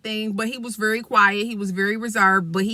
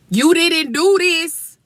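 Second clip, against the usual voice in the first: slow stern telling-off; 8 semitones higher, 5 dB louder, much slower than usual. Retold or imitated. Confronting the boy.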